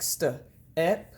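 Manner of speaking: "st" then "ep", separated by a short gap; broken into sounds. Shown as a demonstration. Teacher showing finger tapping, "st, ep" sound by sound. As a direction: sound out "st, ep" in two pieces. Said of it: "break them up into sounds like".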